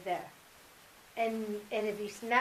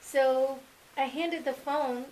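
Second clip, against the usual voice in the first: usual speaking voice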